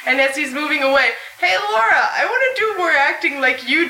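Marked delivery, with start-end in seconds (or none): none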